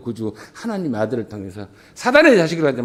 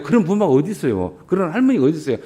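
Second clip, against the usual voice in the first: ordinary talk, nothing marked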